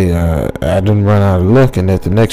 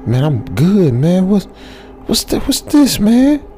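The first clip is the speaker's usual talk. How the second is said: rising higher and higher into a squeak; shaken excitement; 8 semitones higher than usual. Retold or imitated. Narrator pointing at the dust, climbing into a squeaky panic. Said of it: "I was like".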